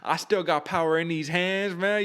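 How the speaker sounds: imitating a Southern fighter's brag in a rough Southern accent; half-hearted Southern drawl impression. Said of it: "I can't do the good Southern accent".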